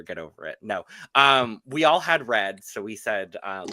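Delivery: ordinary delivery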